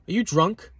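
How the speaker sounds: high, incredulous question; mock his rude question; incredulous man. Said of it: "he says".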